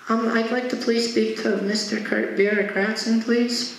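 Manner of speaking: put-on high, childlike polite request; kid. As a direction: ask timidly in a small kid's voice. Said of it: "he feels like a kid".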